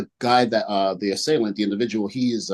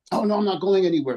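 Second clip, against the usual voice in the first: higher and fast, a put-on stubborn refusal; mock defiant drunk; 8 semitones higher, faster than usual. Drunk drummer refusing the guard, fast and high. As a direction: rattle it off pitched up like a drunk digging in.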